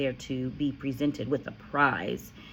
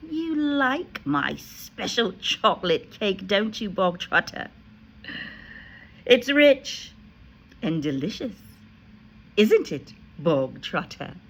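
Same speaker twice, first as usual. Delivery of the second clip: suddenly friendly; 5 semitones higher, 4 dB louder than usual. A narrator's reading. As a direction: coax him falsely sweet. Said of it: "her voice was suddenly softer".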